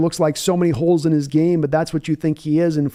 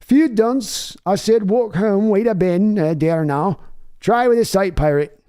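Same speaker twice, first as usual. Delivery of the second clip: second West Country try; 3 semitones higher, slower than usual. Shown as a demonstration. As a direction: push the West Country British accent harder this time. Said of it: "okay, we'll try it here".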